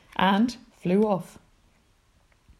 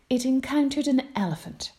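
plain reading voice

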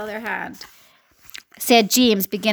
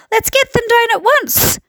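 mighty shout; 12 semitones higher, 3 dB louder than usual. Acted. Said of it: "a mighty shout went up".

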